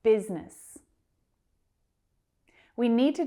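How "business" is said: Business in just two syllables; crisp teacher's model of two-syllable Business. Shown as a demonstration. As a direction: say Business as BIZ-nis, no middle vowel. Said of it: "Just two syllables there".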